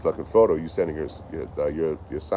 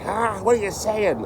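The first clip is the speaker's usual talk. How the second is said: mock yell; 13 semitones higher, slower than usual. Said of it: "some guy's just yelling at me".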